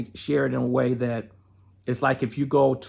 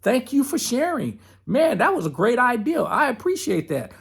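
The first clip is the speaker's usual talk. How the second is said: higher, bright and breezy mock gratitude; playful, higher-pitched polite dismissal; cheerful thanks; 9 semitones higher than usual. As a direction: thank the voice lightly, brushing it off. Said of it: "You can tell the voice".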